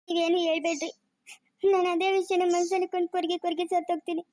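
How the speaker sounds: wounded mother breaking into sobs; tearful reproach. Acted. Mother accuses family of hiding her son's news, tearful. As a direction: plead through tears, then break down crying. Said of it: "ಅಮ್ಮ, ಅಳ್ಬೇಡಮ್ಮ".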